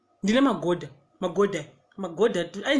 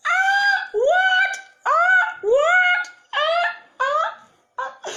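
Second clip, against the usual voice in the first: shocked shriek; 5 dB louder, much slower than usual. Retold or imitated. Shocked reaction to female hotel.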